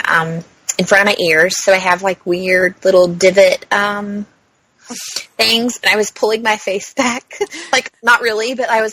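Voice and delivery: usual speaking voice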